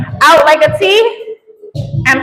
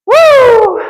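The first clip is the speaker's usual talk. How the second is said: excited cheer; 10 semitones higher, 5 dB louder than usual. Real, not acted. Workout cheer mid exercise.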